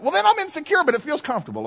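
high-pitched comic protest, falling back at the end; hammed-up indignant self-defence; mock defensive retort. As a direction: play a comically defensive comeback. Mock retort to body-language critics.

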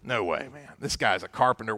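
scoffing fishermen. Retold or imitated. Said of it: "these fishermen are thinking".

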